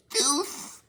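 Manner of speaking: high mocking squeak of the name; mock squeal. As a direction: squeak it like a smug witness. Mocking her answer.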